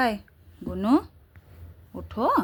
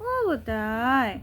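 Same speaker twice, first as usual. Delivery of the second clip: very slow, stretched name; drawn-out call; 2 semitones higher, much slower than usual. Real, not acted. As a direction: draw the name out long.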